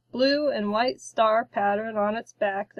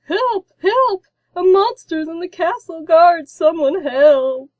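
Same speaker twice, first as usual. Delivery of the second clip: much higher and very loud, slowing at the end; squawking cry for help; 7 semitones higher, 9 dB louder than usual. A narrator's reading. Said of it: "He pulled his tail back and squawked".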